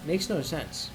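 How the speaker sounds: usual speaking voice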